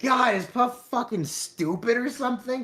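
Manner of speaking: high, exasperated mock groan; mock exasperation. Friends watching Frontiers, groaning at Puff's taste, high and exasperated. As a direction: groan it out like fed-up viewers. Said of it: "we're like".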